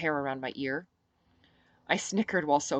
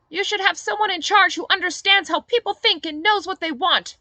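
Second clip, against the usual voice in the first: put-on much higher, louder, lecturing older woman; Sophie, pushy politician; 10 dB louder than usual. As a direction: preach at him like a self-important politician.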